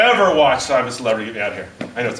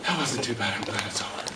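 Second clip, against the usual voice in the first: relieved sigh; 7 semitones lower, 13 dB quieter than usual. Retold or imitated. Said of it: "it's like".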